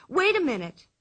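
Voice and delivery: cried out. Acted. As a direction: call them back cheerfully. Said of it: "she cried".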